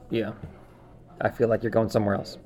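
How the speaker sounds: normal talking voice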